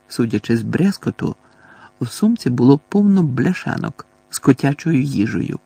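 plain reading voice

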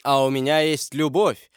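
ordinary delivery

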